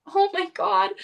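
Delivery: high-pitched dismayed gasp of words; retold high, aghast reaction; overwhelmed. On the phone as it all hits her, aghast. Overwhelmed reply to friend's consoling.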